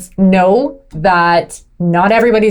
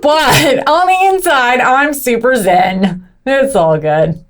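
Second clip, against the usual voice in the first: slow, drawn-out mock serenity; mock zen; 6 semitones higher, slower than usual. Driver insisting she is calm inside, mock zen. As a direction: stretch it out, starting high and settling down. Mock-serene claim after being called aggressive.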